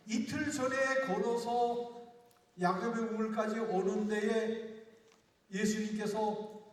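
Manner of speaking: slow it right down and lean on each word; slow emphatic preaching. Real, not acted. Pastor stressing the journey to the congregation, slow and loud.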